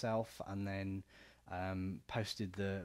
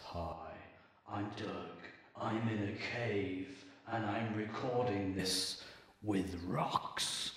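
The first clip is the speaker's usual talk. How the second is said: slow voice with echoey cave reverb; much slower than usual. Shown as a demonstration. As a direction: speak as if echoing round a cave. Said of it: "I can do some really cool effects".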